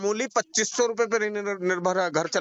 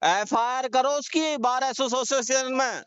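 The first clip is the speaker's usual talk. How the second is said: slow, higher, emphatic command; firm angry order; 5 semitones higher, slower than usual. Real, not acted. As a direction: lay down the law word by word. Heated phone argument, firm orders.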